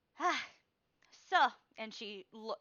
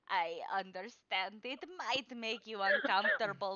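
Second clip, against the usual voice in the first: put-on old fortune teller; 3 semitones higher, 9 dB louder than usual. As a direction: announce her own name with grandeur. Madame Eva speaks in quotes.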